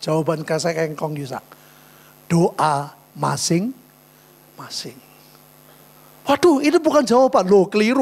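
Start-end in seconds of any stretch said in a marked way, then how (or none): 2.2-5.0 "Doa masing-masing" drawn out very slowly. Real, not acted. Pastor answers the teachers' vaccine question, slow and drawn out. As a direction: stretch every syllable of "Doa masing-masing".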